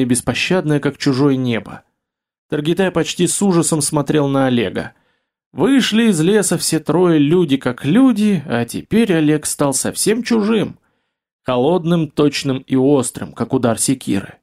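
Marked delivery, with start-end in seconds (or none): none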